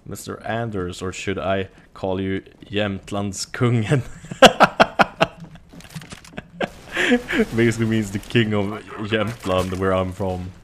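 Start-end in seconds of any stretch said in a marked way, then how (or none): 4.1-7.5 loud amused laugh; high laugh. Real, not acted. Laughing at his own nickname.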